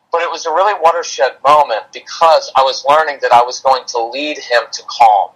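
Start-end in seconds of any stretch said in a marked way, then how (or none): none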